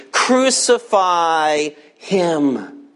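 very slow, drawn-out imitated order; measured, weighty quote of a mob's order; deliberate command. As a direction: stretch each word as a retold demand. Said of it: "They're telling the Roman governor".

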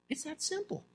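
hushed, lifted little aside. Real, not acted. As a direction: drop it almost under your breath. Preacher drops voice for aside.

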